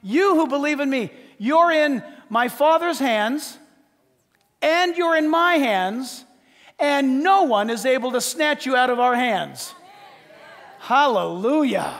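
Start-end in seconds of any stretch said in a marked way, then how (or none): 0.0-9.7 loud, high proclaiming of the promise; Jesus quoted as a sermon cry; preaching shout. Acted. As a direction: build to a shout on the last line. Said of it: "Didn't Jesus say".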